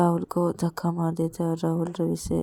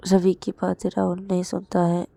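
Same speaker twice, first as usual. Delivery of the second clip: normal talking voice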